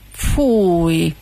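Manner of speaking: drawn-out, falling cry of disgust. Acted. Hooligan recoils from a comb, disgusted. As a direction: recoil on a long "yuck". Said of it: "mondta utálkozva a huligán".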